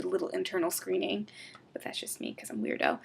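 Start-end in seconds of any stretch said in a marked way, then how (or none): 1.7-3.0 sheepish whisper; whispered self-teasing; whispered aside. Real, not acted. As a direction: confess it in a hush.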